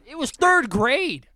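high, loud, slow protest; exasperated correction. Real, not acted. Correcting a friend who said kindergarten, exasperated. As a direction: correct them loudly, exasperated.

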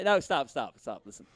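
ordinary talk, nothing marked

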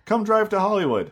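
high, bright eager plea; mock enthusiastic invite; eager invitation. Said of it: "And you were like".

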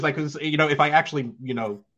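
ordinary talk, nothing marked

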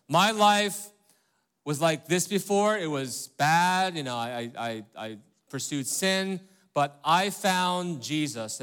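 loud, slow, earnest mock testimony; typical testimony. Pastor mimics a typical convert's testimony, loud and earnest. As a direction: ham up a proud conversion story. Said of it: "testimonies come, go like this".

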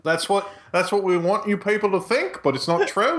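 high, hammed-up Aussie grumbling; mock-complain in a fake Australian accent; broad Aussie twang, pitched high. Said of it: "I'll stop doing that".

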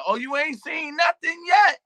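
high, loud exultant shout; voice of God. Retold or imitated. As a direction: proclaim it like a booming revelation. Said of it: "God said".

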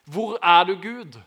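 loud, high-pitched cry to God. Retold or imitated. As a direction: call out hard, then let the last word drop in volume. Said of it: "skrike til han og rope til han". (høyt, ropende til Gud)

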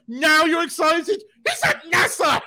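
high, loud, slow teasing jab; mock exasperated wife. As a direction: tease him, high and loud, through laughter. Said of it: "she goes".

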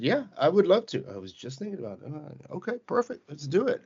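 eager yes. Retold or imitated. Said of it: "And I said".

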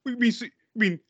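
high, rising mock cop voice; squeaky, incredulous movie-cop parody; mocking actor quote. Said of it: "Lethal Orson was like".

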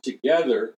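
together stressed firmly, with a falling end; emphatic, deliberate together. Real, not acted. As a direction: lean on the word together. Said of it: "let me emphasize the word".

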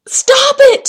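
high, loud scream; full-force frantic yell. A narrator's reading. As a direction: shriek it at the top of your lungs. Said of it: "screamed Jack at the top of his lungs".

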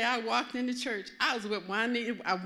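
ordinary talk, nothing marked

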